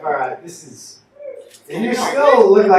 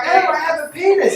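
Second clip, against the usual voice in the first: "Oh, I have a penis" in a higher, posh British lilt; mock British accent, higher pitched; 10 semitones higher than usual. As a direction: send up a British gent. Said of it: "British people are like".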